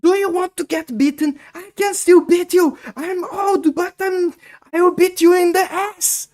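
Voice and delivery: very high, loud put-on mother voice threatening a beating; angry mom threat. Son imitates his mom threatening to beat him, very high and loud. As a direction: shriek the threat like an outraged old mom. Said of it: "She was like".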